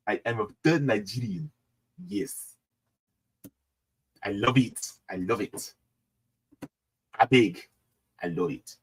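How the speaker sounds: soft put-on Nigerian-style accent; quiet, jokey African-accented delight. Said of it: "general African accent".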